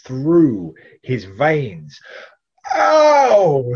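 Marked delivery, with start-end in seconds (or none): 2.7-3.7 sharp, high, loud yelp of pain; pained cry. A narrator's reading. Man bitten by the dragon cries out in pain. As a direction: cry out as the venom bites. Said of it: "he cried".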